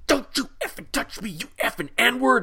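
high shrieking mock rage; angry shout. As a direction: scream at him like a furious stranger. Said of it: "she just loses it".

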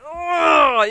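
high, loud mock groan; hammed-up groan of exasperation; aggravated groan. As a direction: groan loudly, as if fed up with a friend. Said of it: "she used to aggravate the heck".

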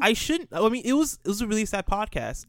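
high-pitched self-justification; defensive protest. Real, not acted. Defends himself after being teased.

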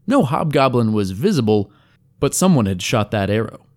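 ordinary delivery, nothing marked

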